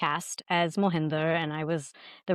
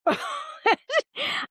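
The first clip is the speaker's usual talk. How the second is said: high laugh. Real, not acted. Embarrassed friend moment.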